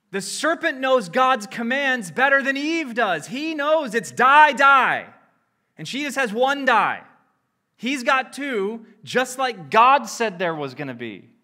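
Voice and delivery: loud, high, driving sermon voice; preaching at full force. Acted. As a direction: preach it hard, hammering each word. Preacher hammers the point.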